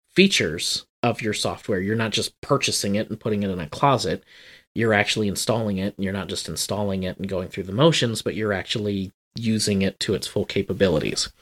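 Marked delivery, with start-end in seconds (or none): none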